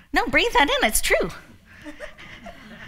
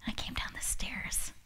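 whisper; 13 dB quieter than usual. Retold or imitated. Sneaking down to surprise husband.

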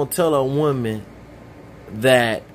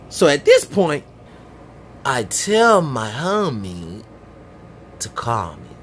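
ordinary talk, nothing marked

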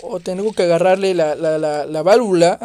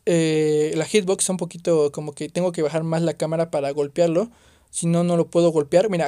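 ordinary talk, nothing marked

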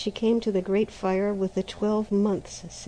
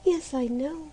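put-on sad girl; 8 semitones higher than usual. A narrator's reading. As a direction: sigh it out, resigned. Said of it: "said Maruschka sadly".

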